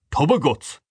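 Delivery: mildly vulgar scorn; coarse. A narrator's reading. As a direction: toss it out as a crude insult. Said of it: "said Mister Dedalus coarsely".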